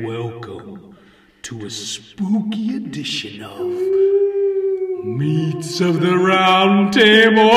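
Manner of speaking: slow, drawn-out horror host voice; spooky vampire host. Acted. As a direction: stretch it out like a ghoul. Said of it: "Count [name] Garnula".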